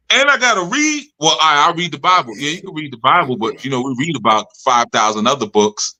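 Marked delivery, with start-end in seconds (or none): 0.1-1.1 higher whiny complaint; mock whining student. Student complaining to the teacher, higher mock whine.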